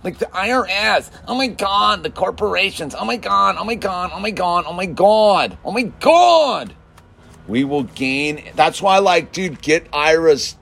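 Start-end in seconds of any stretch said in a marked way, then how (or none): 0.0-6.7 high, whiny, rising panic over everything; hammed-up frantic oh-my-God complainer; mock panicked whiner. Mocking selfish worriers, high hammed-up panicked whining. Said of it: "What are selfish things?".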